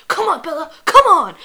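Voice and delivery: high, loud imitated urging; mock urgent plea. Jacob urging while reviving her.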